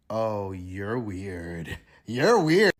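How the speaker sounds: slow drawn-out weirded-out oh; mock disgust. Real, not acted. Reacting to Andrena's fakeness, slow and drawn out. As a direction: stretch the oh in disbelief.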